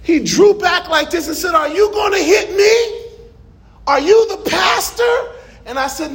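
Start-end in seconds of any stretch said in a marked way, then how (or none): 1.5-5.3 much higher, crying-edged shout; voicing a scared homeless man; frightened man. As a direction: flinch back and cry out. Homeless man recoils, afraid.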